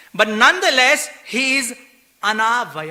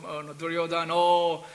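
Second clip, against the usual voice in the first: mock sad lament; 3 semitones lower, 6 dB quieter than usual. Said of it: "and they're all, you know, sad".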